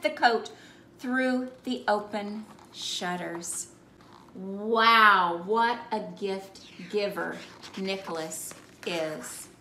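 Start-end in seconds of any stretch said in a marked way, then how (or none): none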